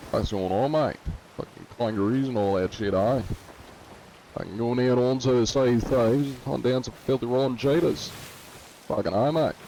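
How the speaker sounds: broad Aussie slang delivery; Australian accent. Shown as a demonstration. Streamer imitating Australians for viewers, Australian accent. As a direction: talk like an Aussie bloke. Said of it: "Australians just be like".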